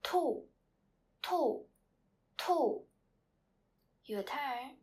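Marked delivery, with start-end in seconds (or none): 0.0-2.8 "Tu" loud and high, falling sharply; fourth tone. Shown as a demonstration. Teacher drilling students to repeat, fourth tone on "Tu". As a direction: say each "Tu" separately with a steep drop. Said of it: "Repeat after me for two times".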